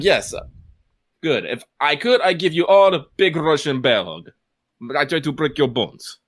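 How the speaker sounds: playful, hammed-up Russian accent; Sanya's Russian accent. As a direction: put on a big Russian bear of a voice. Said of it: "he loves James Marshall's renditions of Sanya".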